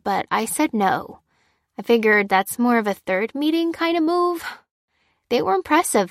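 lilting voice with swooping pitch; animated friend sharing what she learned; chatty, informed friend. Acted. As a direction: explain it brightly, with casual hedges. Voices Fliss explaining the party.

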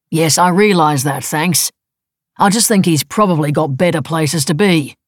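loud, bitter retort. A narrator's reading. Husband answering wife's accusation, bitter. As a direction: concede it testily, with bitterness. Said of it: "She detected bitterness".

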